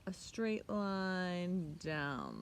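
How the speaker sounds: very slow, drawn-out; slow demonstration. Shown as a demonstration. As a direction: draw the words out. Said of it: "teacher demonstrates".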